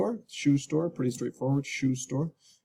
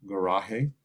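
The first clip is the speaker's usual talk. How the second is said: garaje with a Spanish h sound, ga-RA-he; Spanish pronunciation. Shown as a demonstration. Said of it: "Isn't that in Spanish".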